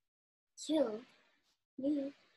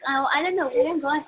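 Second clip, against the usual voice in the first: ordinary talk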